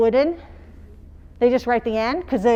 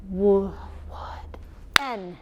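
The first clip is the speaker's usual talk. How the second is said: whispered first clap, softer; 5 dB quieter, slower than usual. Shown as a demonstration. Teacher demonstrating the whispered first clap. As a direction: breathe the sounds out without voice. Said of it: "You whisper the first clap".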